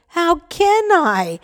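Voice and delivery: high, slow tearful plea. Acted. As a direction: plead through tears, slowly. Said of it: "tears streaming down her face".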